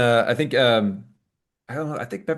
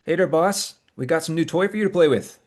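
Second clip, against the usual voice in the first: higher, louder in-character greeting; Bevan's voice; 9 semitones higher, 5 dB louder than usual. Acted. Bevan presents a new toy to the boss, higher and louder. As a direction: pitch it up and hail the boss. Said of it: "He's like".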